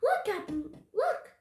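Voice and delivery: high, loud, excited cry; overjoyed pirate calling to his captain; gleeful shriek. A narrator's reading. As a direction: squeal it out with glee. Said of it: "A gleeful shriek".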